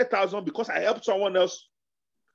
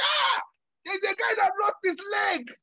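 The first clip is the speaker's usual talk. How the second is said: mock high-pitched reply; 10 semitones higher than usual. Acted reply quote, high.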